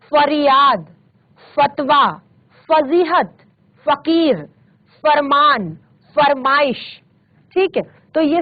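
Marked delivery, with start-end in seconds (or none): 0.1-7.0 फरियाद, फतवा, फजीहत, फकीर, फरमान, फरमाइश all on f; nukta f sound. Shown as a demonstration. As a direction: open each word from फरियाद to फरमाइश with f, teeth on lip. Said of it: "नुक्ता के साथ ही करेंगे".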